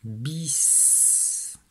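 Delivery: "bis" ending in a long hissed s; "bis" read out as biss, ending stretched; syllable reading. Shown as a demonstration. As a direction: hold the voiceless s of "bis". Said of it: "je lis la syllabe".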